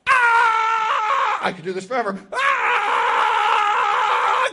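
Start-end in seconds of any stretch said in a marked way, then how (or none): 0.1-1.4 long, high, loud scream; leopard's cry. Acted. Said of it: "we all heard him cry out". 2.3-4.5 long, high, loud scream; leopard's cry. Acted. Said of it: "we all heard him cry out".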